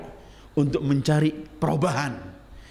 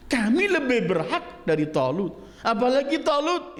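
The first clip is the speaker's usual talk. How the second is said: indignant protest; 11 semitones higher than usual. Acted. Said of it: "mereka teriak".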